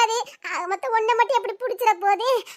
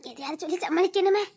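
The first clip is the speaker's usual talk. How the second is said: soft muttering to oneself; worried aside; 2 semitones lower, 9 dB quieter than usual. Acted. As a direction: think aloud under your voice. Aside, searching for an excuse.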